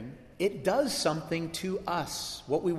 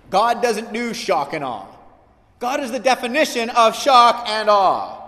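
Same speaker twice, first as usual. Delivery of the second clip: louder and louder, climaxing; preaching build; 6 semitones higher, 9 dB louder than usual. Real, not acted. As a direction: build to a ringing declaration. Sermon climax.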